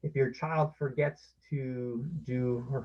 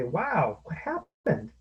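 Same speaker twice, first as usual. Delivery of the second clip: much higher, louder surprised concern; sympathetic surprise; 11 semitones higher, 4 dB louder than usual. Shown as a demonstration. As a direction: gasp with the child over the stolen bike. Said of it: "you can say".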